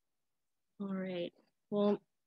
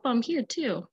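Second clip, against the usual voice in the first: ordinary delivery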